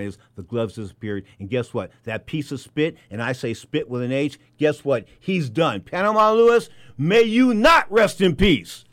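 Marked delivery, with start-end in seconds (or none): 5.8-8.8 loud and slow, spitting each word; damn him on the way out; host cursing the dead trainer, loud and slow; bitter loud curse. Real, not acted. Host's bitter sign-off curse.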